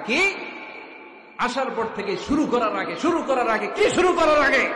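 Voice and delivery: high, loud outburst building to a scream; furious wife. Acted. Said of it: "স্ত্রী বেচারি রেগে মেগে আগুন". (রেগে আগুন স্ত্রীর চিৎকার)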